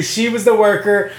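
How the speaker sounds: usual speaking voice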